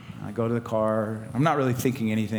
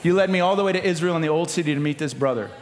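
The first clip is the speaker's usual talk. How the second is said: high, loud preacher delivery; full-force preaching; 10 semitones higher, 5 dB louder than usual. Real, not acted. Preacher urging the church to let the Spirit lead, full-force preaching. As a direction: drive it home to the congregation, loud and high.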